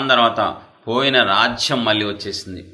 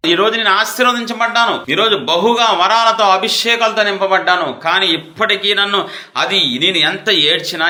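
high, loud pulpit cry; fiery preaching; 6 semitones higher, 3 dB louder than usual. Acted. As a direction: preach it out at full force. Preaching at full force.

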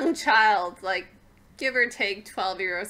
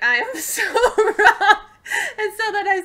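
laughing, slow; 12 semitones higher, 9 dB louder, slower than usual. Retold or imitated. Realizing the theory failed.

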